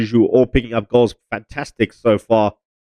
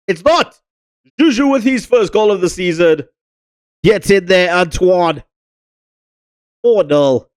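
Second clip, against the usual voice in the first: excited goal call; 8 semitones higher than usual. Acted. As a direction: shout it like a commentator. Goal commentary celebration.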